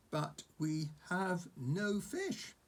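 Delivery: put-on patient boy. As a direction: spell it out slowly to a pet.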